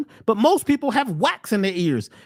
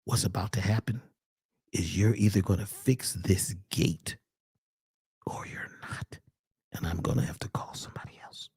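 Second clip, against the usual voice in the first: retold hushed whisper, much softer; 9 dB quieter than usual. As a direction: lean in and whisper the threat. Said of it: "Lean closer, brother".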